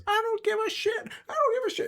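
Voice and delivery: very high, loud falsetto panic; frantic Mark. Acted. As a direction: squeal it loudly while bolting away. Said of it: "He goes".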